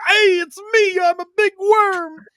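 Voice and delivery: high, loud goofy greeting; imitating Big Worm. Mocking Big Worm as an Italian, high loud goofy greeting. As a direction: announce yourself like a proud big oaf. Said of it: "Is just be like".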